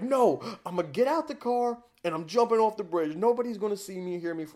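desperate yelling at his mom; hysterical shouting. Retold or imitated. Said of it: "I'm just like".

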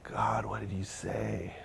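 slow, hushed muttering to yourself; quiet, drawn-out replay of a regret; softly agonize over your own words; self-reproach. Retold or imitated. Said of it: "go home and just go".

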